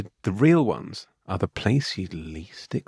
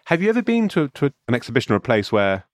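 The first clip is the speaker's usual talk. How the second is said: ordinary delivery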